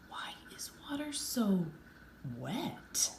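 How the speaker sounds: soft whispered musing; wondering whisper. A narrator's reading. Marco wondering aloud in a whisper at sea. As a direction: whisper it like a puzzled thought. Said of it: "Marco wondered".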